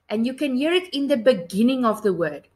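usual speaking voice

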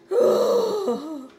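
big theatrical sigh, high and loud. Acted. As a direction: heave a long loud sigh. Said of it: "and he's sighing".

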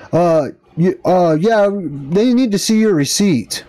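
hesitant, drawn-out imitation of police; mocked cops. Cops telling customer about the receipt, mocking imitation. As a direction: lightly mimic a slow-witted official stalling. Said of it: "And they're like".

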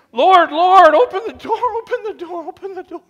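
high, loud desperate pleas fading; frantic high cry for the door; desperate pleading. Acted. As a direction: beg at a locked door, then fade. Shut-out people pleading.

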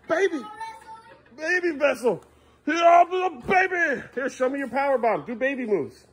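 put-on high playful baby voice; high cutesy voice; baby talk. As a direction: coo at the toddler.

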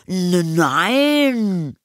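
high, stammered, drawn-out denial; indignant boy. A narrator's reading. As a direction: stumble into an offended child's denial. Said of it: "rief Anton empört". (empörtes, gedehntes Nein, hoch)

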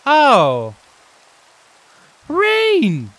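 loud, high burst of surprise; startled cry. Real, not acted. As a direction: cry out as rain suddenly starts. Startled by sudden rain in game.

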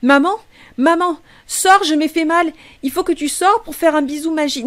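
put-on high, pleading small-boy voice, dragging out sors; a hurt child begging his mother to come out; little boy calling mum. Little Blake calls for his mother, high and pleading.

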